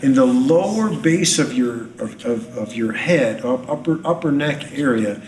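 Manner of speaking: fervent preaching. Real, not acted. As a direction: preach it with rising fervor. Healing word, rising fervor.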